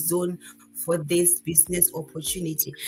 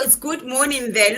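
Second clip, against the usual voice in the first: ordinary delivery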